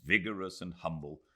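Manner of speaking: ordinary delivery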